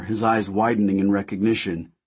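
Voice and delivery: usual speaking voice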